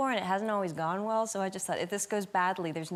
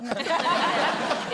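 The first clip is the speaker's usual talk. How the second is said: loud high laugh; high burst of laughter; 10 semitones higher, 7 dB louder than usual. Real, not acted. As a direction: crack up laughing. Laughing at the joke.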